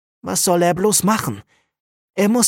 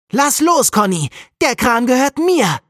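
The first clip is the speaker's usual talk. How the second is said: put-on high, loud, grabby child's scream; 10 semitones higher, 4 dB louder than usual. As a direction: scream it like a boy fighting over his toy. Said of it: "schreit Jakob".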